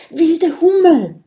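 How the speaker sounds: high, loud surprised call of a name. A narrator's reading. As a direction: call her name out in relief. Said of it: "ruft Kokosnuss". (laut überraschter Ruf)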